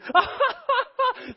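very high cackling laugh; imitated laughter. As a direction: mimic a cousin's high-pitched laughing fit. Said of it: "starts laughing".

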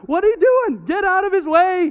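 exasperated yell. Retold or imitated. Father shouting at daughter to get away, loud. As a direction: shout at her to move.

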